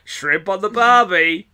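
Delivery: slow hammy fake Australian; bad Australian accent. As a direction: lay on a fake Aussie accent. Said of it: "bad Australian accents".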